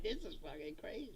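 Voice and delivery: very soft, disbelieving aside; stunned aside. Acted. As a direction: say it under the noise, astonished. Stunned onlooker's aside at convention.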